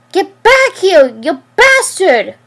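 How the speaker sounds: furious yell, drawn out; screaming. Acted. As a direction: scream it after him. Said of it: "Riley screamed".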